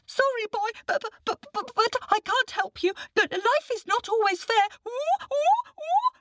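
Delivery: very high, jittery stammering monkey voice; a nervous little monkey tripping over every word. Acted.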